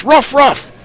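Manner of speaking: high barking yelp of a dog; squeaky talking dog. Acted. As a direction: bark the words like a dog. Dog in joke speaks.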